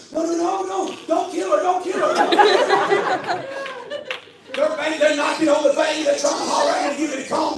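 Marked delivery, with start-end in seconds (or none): 0.1-3.4 higher-pitched mock pleading; comic, repeated begging from bystanders; panicked onlookers. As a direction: imitate relatives frantically calling him off. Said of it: "our family and friends are like".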